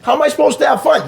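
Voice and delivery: high, hurried mock complaint; protesting kid. Son complaining to his mother in a story, exasperated protest. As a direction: whine back like a put-out child.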